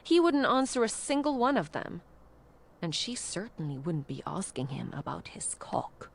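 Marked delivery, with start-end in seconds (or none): 2.8-6.1 hushed, low and soft; whispered. A narrator's reading. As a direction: drop to a secret whisper. Narrator whispers the line.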